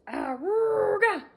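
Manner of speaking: slow, high, loud horn call; loud honk call. Acted. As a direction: blast it like a bus horn. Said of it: "Harry honked his horn".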